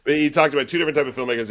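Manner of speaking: laughing. Real, not acted. Laughing after banter.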